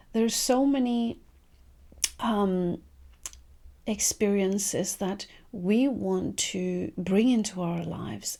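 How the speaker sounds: ordinary talk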